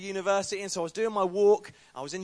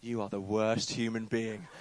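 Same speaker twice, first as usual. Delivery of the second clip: disgusted woman; 9 semitones lower, slower than usual. Retold or imitated. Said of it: "she stared at me ... and she said".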